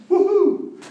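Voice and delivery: high whooping cheer. Acted. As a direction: whoop like it's all finished. Celebrating being done.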